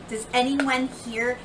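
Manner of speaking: plain reading voice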